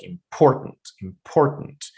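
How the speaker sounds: "important" said im-POR-tant, middle syllable strong; stress on POR. Shown as a demonstration. Teacher repeating the example, middle syllable stressed. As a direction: punch POR in "important", keep im light. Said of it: "only part that is, uh, stressed is por".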